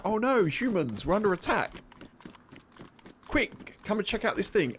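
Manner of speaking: high, urgent mock alarm from the dog; voicing the dog. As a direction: play the dog as a fake-panicked hero. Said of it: "He'll be like".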